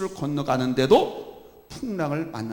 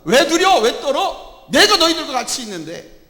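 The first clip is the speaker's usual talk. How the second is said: much higher, louder scolding shout; rebuke; 12 semitones higher, 10 dB louder than usual. Acted. Jesus shouts a rebuke at the fearful disciples. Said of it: "바로 그걸 책망하는 거".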